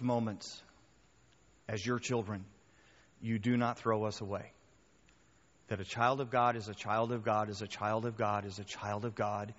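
low, soft pastoral prayer. Real, not acted. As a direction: pray quietly with unhurried pauses. Leads closing prayer.

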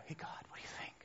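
hushed whisper to God. Acted. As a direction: whisper it as if He is right beside you. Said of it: "I can't whisper to God".

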